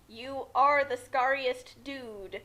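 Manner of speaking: ordinary talk, nothing marked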